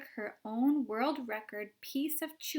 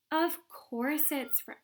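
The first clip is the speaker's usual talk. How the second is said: defiant retort; 5 semitones higher, 3 dB louder, slower than usual. Acted. Violet retorting.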